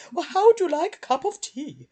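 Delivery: high, hammed-up posh British accent; mock posh British. Mocking overdone British dubs, high posh British voice. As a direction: ham up a posh British tea offer. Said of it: "really British".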